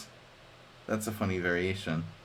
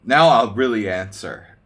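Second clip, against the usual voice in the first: much louder, emphatic payoff; loud punchline; 12 dB louder than usual. Real, not acted. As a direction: land the joke loud. Punchline of the story.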